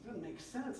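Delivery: high-pitched incredulous protest; acted-out indignant complaint; Habakkuk. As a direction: object in baffled disbelief, pitch way up. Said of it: "he complains to God".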